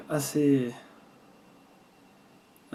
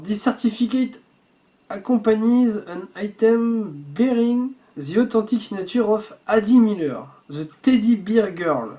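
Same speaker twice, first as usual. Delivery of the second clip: slow, higher-pitched English in a French accent; 3 semitones higher, 3 dB louder, slower than usual. Real, not acted. Reading the certificate to viewers, slow French-accented English. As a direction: read the English slowly, French accent throughout. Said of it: "désolé pour mon accent anglais".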